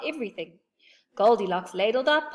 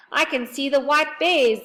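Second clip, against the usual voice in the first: higher, louder alarm; alarmed bear; 7 semitones higher, 8 dB louder than usual. A narrator's reading. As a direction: raise the alarm. Alarmed discovery.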